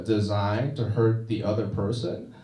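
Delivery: usual speaking voice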